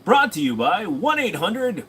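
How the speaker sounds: hammed-up Moviefone announcer. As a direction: sell it like an old Moviefone intro. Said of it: "Great voice".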